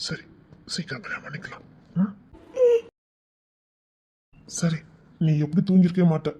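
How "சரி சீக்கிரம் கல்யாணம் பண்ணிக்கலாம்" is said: hushed, softer tender whisper. Acted. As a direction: breathe the promise close to her ear. Tender promise to crying lover.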